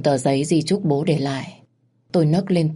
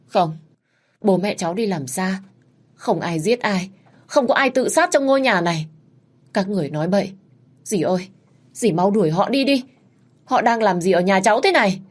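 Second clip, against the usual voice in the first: high, rising frantic refusal; shouting denial at the police; distraught denial; 4 semitones higher than usual. Acted. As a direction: push the truth away. Daughter rejects police questions.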